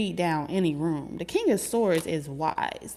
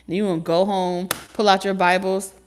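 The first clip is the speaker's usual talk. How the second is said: ordinary delivery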